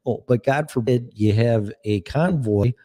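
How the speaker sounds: ordinary delivery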